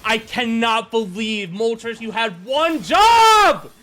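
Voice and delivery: slow, high shout building to a scream; real gamer rage; outraged yell. Real, not acted. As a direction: yell in disbelief, dragging out the last word. Yelling at Pokémon that missed.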